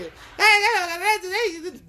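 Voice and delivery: mock Guerrero yell. Mimicking people from Guerrero calling out, loud mock yell. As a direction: holler like a rowdy guy from Guerrero.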